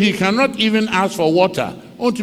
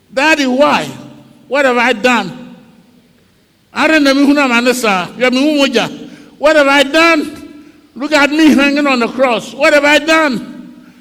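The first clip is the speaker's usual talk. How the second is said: higher and louder, pleading with the father; anguished pleading; 7 semitones higher, 5 dB louder than usual. Acted. Jesus hanging on the cross pleads loudly with his father. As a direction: beg to know why it is happening.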